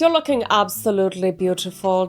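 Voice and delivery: hammed-up, very shitty German accent; bad German accent. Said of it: "speaking in a very shitty German accent".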